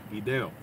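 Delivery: "Video" said low, vi-DAY-o, DE stressed; stress on second syllable. Shown as a demonstration. Guessing the South American pronunciation, DE stressed in "Video". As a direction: say "Video" with the accent on the E. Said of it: "how they pronounce it there".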